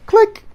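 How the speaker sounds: sharp high mouth click like a shutter; mock shutter sound; camera click. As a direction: snap the word out, very high and loud. Imitating camera snapshot.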